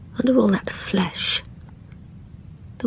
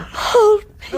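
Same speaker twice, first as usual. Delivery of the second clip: high, louder, near tears; tearful plea; 13 semitones higher, 4 dB louder than usual. Acted. Girl crying to the old woman for comfort. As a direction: reach for comfort through the sobs. Ghost begging to be held.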